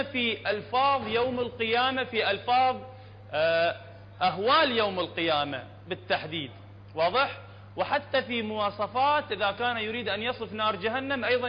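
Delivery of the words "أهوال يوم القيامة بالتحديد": ordinary delivery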